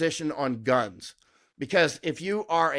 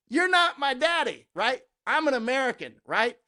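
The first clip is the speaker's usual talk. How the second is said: pitched up high and cocky; defiant retort; 7 semitones higher than usual. Retold or imitated. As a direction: fling it back at them.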